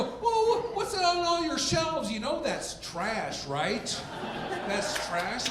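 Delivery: high mock-shocked friend, slowing on trash; mock the friends in a high, scornful voice. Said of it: "My friends go".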